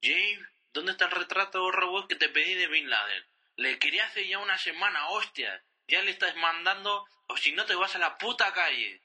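high, loud furious yelling; angry boss shouting. Acted. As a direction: bark orders like a raging boss. Boss yells at employee.

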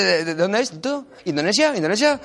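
normal talking voice

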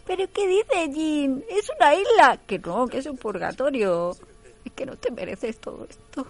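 high, loud mock-sobbing argument; hammed-up weepy soap voices. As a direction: wail it like a telenovela. Melodramatic couple scene voiced.

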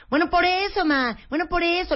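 put-on high, dismissive teen brushing mom off; teen daughter. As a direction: mimic the bored daughter cutting her short. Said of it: "Y entonces empezó".

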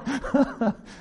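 high burst of laughter. Real, not acted. Speaker laughing about old anger, high laugh.

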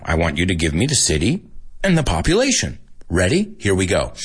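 ordinary delivery

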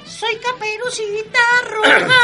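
put-on high, slow sing-song girl; little girl voice. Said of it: "ahí va".

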